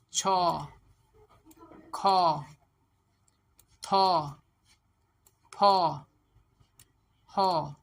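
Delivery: छौ, खौ, थौ, फौ, हौ loud, each with long open "aw"; syllable drill. Shown as a demonstration. Teacher models syllables to practise, loud drawn-out छौ to हौ. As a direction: punch out छौ, खौ, थौ, फौ, हौ with long pauses between. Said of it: "sounds के साथ कोशिश करें".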